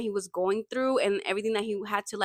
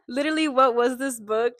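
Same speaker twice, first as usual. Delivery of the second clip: higher and louder in disbelief; incredulous; 4 semitones higher, 5 dB louder than usual. Real, not acted. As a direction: throw the question out, stunned. Repeats her question in disbelief.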